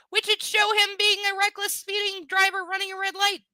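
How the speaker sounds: high, exasperated run of words; incredulous rant. Real, not acted. Reader objecting to the reckless driver detail, incredulous rant.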